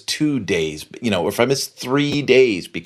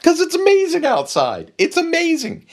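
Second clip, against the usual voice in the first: high, thrilled gush about the storm; excitedly insisting it's amazing outside. Retold or imitated. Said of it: "And I'm like".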